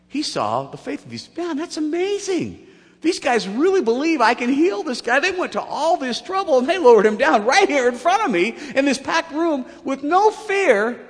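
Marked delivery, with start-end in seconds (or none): 1.4-11.0 high, loud, marveling outburst; acted amazement. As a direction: play being hugely impressed. Acts Jesus amazed at their faith.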